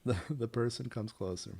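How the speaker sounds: usual speaking voice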